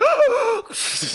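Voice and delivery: loud, high mock gasp; big hammed-up gasp of horror; shocked gasp. Elena watches Damon snap Matt's neck, hammed-up shocked gasp.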